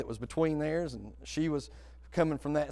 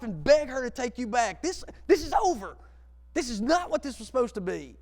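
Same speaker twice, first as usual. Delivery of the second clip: upset woman; 12 semitones higher, 7 dB louder than usual. Retold or imitated. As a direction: play the panicked girlfriend, high and insistent. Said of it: "She goes".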